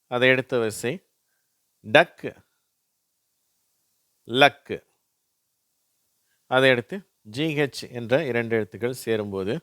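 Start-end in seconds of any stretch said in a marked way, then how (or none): 1.8-4.7 "டக், லக்" loud, ending in k; word-list demo. Shown as a demonstration. Teacher showing CK to learners, "டக், லக்" loud with a pause between. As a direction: say "டக், லக்" as separate, projected model words. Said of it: "அது இக்கு அப்படின்னு தான் உச்சரிக்கப்படுது".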